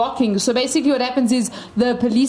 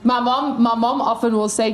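ordinary delivery

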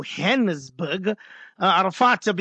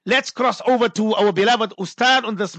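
ordinary delivery

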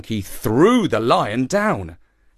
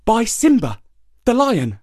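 much higher sly hare; high, bright little hare; 11 semitones higher than usual. A narrator's reading. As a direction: announce the suitor proudly.

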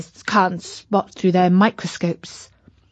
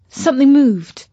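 surprised; 5 semitones higher, 6 dB louder than usual. A narrator's reading. Sudden discovery while searching.

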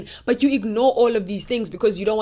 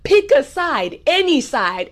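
emphatic call; 5 semitones higher, slower than usual. Real, not acted. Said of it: "Luke, no, no, no".

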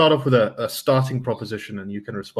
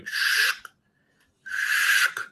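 paper rustle sound. Shown as a demonstration. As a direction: mimic paper being folded. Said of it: "the sound of the paper going".

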